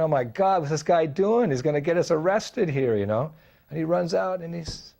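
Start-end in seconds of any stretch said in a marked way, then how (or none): 0.0-3.0 alarmed. Retold or imitated. Narrator's alarmed thought.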